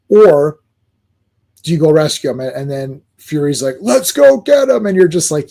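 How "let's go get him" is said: high, slow rallying call; rally the squad to go; hammed-up hero call, pitched high; rallying cry. Fury rallying the team.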